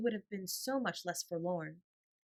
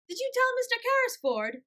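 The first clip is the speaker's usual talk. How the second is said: put-on much higher, louder child's shout; shouting boy; 10 dB louder than usual. A narrator's reading. Little Donald shouting his question across the room, much higher and louder. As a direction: call out like an eager small boy. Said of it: "Donald shouted again".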